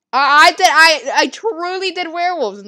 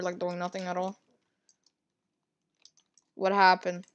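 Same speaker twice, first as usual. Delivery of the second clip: normal talking voice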